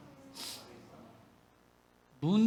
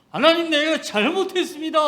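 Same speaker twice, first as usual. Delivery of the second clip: higher, louder plea to God; loud repentant cry; 8 semitones higher, 8 dB louder than usual. Retold or imitated. Preacher reliving his repentance, crying out to God. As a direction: cry out the confession. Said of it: "회개를 즉석에서 회개를 했어요".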